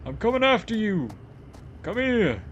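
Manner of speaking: high, loud, hammy cop yelling; mock police shout. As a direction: shout like a cartoon cop chasing. Mocking the police chase.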